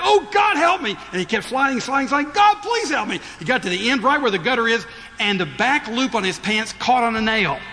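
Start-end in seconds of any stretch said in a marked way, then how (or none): 0.0-1.0 high desperate cry to God; a man sliding off a roof yelling for help; panicked plea, pitched high and loud; cried out. Retold or imitated. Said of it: "he cried out". 2.3-3.2 frantic high call for help; desperate cry. Retold or imitated. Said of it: "he cried out".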